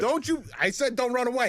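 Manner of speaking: high, loud mock command; mock yell. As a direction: bark at a fleeing target. Voicing shooter yelling.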